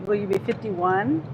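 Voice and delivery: ordinary talk, nothing marked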